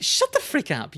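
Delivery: mock snap. Retorting to condescending people, mock angry. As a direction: snap back at a know-it-all. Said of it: "It's like".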